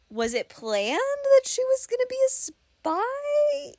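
very high, squeaky mock bafflement; baffled disbelief. Reader acting out confusion at the plot twist, high baffled squeak.

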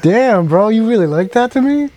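high, loud, playfully wounded; mock offended. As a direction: act hurt he got sent to voicemail. Friend ignores his call.

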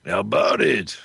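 Watto impression, alien trader. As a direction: croak it like Watto. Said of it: "that was Watto".

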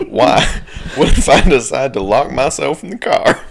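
mock protest. Reader voicing the listener's doubt to themselves, mock protest.

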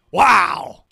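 a loud, thrilled wow; excited shout. Real, not acted. Fan reacting to the game, loud excited wow. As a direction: yell it at the big play.

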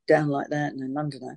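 a hint of a low London character. Shown as a demonstration. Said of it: "the character in Minder was very".

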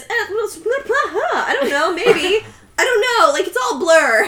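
high, stammering, giggly excuse; evasive son. Retold or imitated. Son questioned by parents about leaving Tommy, evasive. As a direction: squeak out a feeble dodge, half laughing.

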